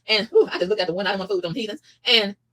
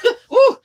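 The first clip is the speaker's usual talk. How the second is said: a high, loud laughing whoop; 9 dB louder than usual. Real, not acted. Telling viewers about the paranoid man, laughing loud. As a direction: cackle out loud at the memory.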